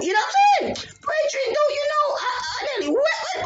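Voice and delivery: high, still rattled; telling viewers how scared she was, pitch still high; shaken excitement. Real, not acted. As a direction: keep the voice up high in shock.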